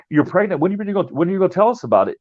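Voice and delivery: high, hurried demand in a retold quote; retold confrontation. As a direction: put it to her as the questioning dad. Acted quote of father confronting daughter.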